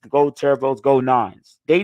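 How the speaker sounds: ordinary talk, nothing marked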